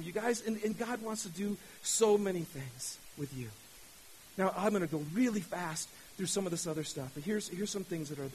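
ordinary talk

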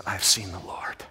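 hushed whisper of wonder; whispered awe. Acted. Mary telling the disciples, whispered in awe. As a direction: breathe it out in awe. Mary's awed news.

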